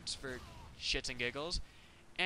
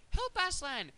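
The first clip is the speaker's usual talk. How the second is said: higher mock cry for help; hammed-up little plea; mock girl pleading; 4 dB louder, slower than usual. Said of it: "as she's like".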